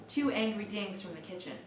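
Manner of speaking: ordinary delivery, nothing marked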